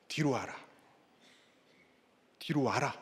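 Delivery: low, soft summons; hushed, patient acted call; the Lord calling. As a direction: call them back quietly, leaving space. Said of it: "이렇게 부르시는 주님의 음성".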